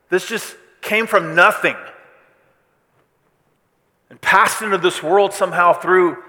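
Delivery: loud, high-pitched awe from the pulpit; forceful preaching. Acted. Preacher marveling at a new baby to the congregation, forceful wonder. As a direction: preach it big, voice lifted in wonder.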